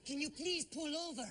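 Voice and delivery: impression playing a cop pulling someone over. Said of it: "do a different impression".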